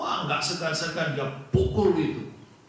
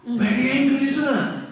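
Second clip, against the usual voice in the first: emphatic; 10 semitones higher, 4 dB louder than usual. Acted. Preacher pushing the father's anger.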